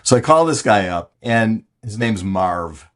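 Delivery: ordinary talk, nothing marked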